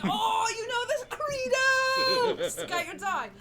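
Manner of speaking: high, loud yell; rallying shout. Acted. Street kid yells skate or die to the crew, high and loud. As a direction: belt the motto out high. Urchin yells the gang motto.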